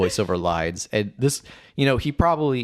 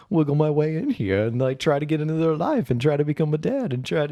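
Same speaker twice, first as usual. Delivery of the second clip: creepy inner voice; 8 semitones higher than usual. Retold or imitated. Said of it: "and it's like".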